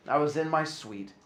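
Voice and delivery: ordinary delivery, nothing marked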